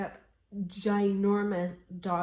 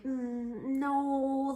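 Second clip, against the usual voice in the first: drawn-out hum, then a higher, louder no; hesitant then firm no; 9 semitones higher, 3 dB louder than usual. Shown as a demonstration. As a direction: mull it over, then turn it down. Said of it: "Kinda like that, right?".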